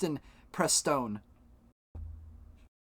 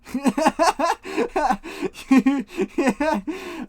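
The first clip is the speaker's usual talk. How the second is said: a burst of loud, high laughter; 10 semitones higher, 6 dB louder than usual. Real, not acted. Streamer bursts out laughing at chat, loud high laugh. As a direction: crack up loudly at chat.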